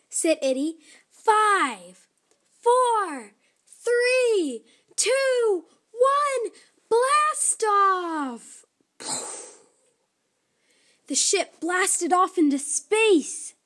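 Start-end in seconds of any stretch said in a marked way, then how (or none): 1.1-8.6 rising higher and louder to blast off; excited countdown. Acted. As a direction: count down thrilled, building to the launch. Countdown to launch.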